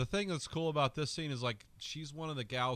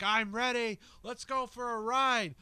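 high, loud mock yell of a crude thug; imitating Frank yelling; 13 semitones higher, 6 dB louder than usual. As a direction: bellow it up high like a vulgar gangster. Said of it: "And he's just yelling it".

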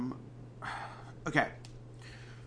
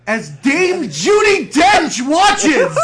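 laughing shout; 11 semitones higher, 10 dB louder, much slower than usual. Real, not acted. Punchline of absurd story.

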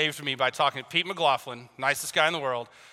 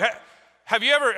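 usual speaking voice

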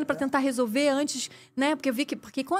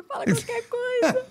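high, slow tearful pleading; retold tearful begging; crying plea; 13 semitones higher, slower than usual. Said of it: "Eu chorava assim".

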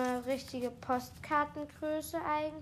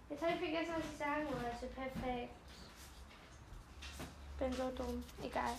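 softer, fading out; trailing off; 9 dB quieter, faster than usual. Real, not acted. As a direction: let it die away. Forgot, self-deprecating.

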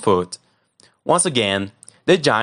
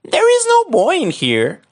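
much higher, thin wife voice; giant's wife; 4 dB louder than usual. Acted. Wife hides Jack from the giant, high and innocent. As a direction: squeak it out innocently. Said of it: "The wife said".

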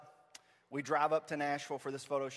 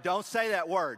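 louder, playful admonishment; mock scolding; 3 semitones higher, 7 dB louder than usual. Preacher to kids in the room, mock scolding. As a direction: scold with pretend sternness.

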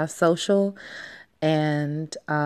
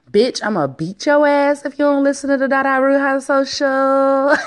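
playful mock threat, higher and brash; sassy threat; 7 semitones higher, 3 dB louder than usual. Host jokingly threatens listeners in sassy voice. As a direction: tease listeners with a fake threat. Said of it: "Beat as in".

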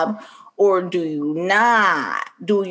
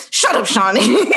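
higher-pitched irritated shutdown, laughing; mock snappy retort; 4 semitones higher, 4 dB louder than usual. [name] silencing onlooker Shawnee, mock snap with laughter. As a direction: imitate an annoyed mom silencing someone.

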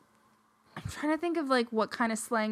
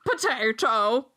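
"Potato" drawn out slowly in mock Irish, louder; mock Irish accent; 5 dB louder, slower than usual. Imitating Josh from the episode, exaggerated Irish accent. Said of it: "but they had Irish accents".

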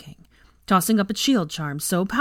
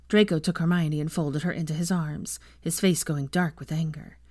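usual speaking voice

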